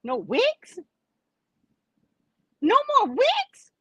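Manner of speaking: high-pitched incredulous question; shocked disbelief. Acted. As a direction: squeak it up high in comic shock. Comic shock at losing wigs.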